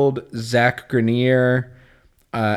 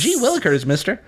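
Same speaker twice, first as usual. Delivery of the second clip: put-on high, eager kid exclamation; wholesome 1950s kid; 6 semitones higher than usual. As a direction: squeal it like a wholesome boy. Continues kid bit.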